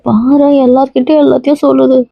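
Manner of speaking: playful wife scolding her husband, coy and whiny; coy complaint. Acted. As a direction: tease him with a sulky pout. Wife coyly complains about husband.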